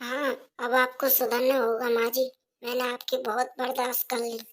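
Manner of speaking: soft but firm refusal; daughter-in-law talking back to her mother-in-law, firm; defiant daughter-in-law. Acted. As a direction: finally stand up to her, quietly.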